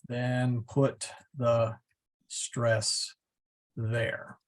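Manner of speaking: word by word, with long pauses; drawn-out words, spaced for writing down; slow dictation. Real, not acted. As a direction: dictate it slowly as you type. Typing out the rule while speaking.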